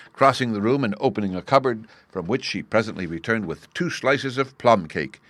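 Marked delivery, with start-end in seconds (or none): none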